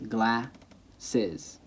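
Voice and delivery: GLASSES split as GLA, pause, siz; syllable by syllable. Shown as a demonstration. Teacher showing vowels anchor syllables, glasses broken in two. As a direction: break glasses after the first vowel. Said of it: "syllables are really anchored in the vowels".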